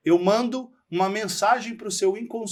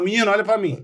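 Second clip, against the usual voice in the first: louder, higher imitation of a scolding mother; mock maternal scolding; 4 semitones higher, 6 dB louder, faster than usual. Mother telling off her child, mock scolding. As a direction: scold like a mother demanding attention. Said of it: "a mãe quando dava bronca".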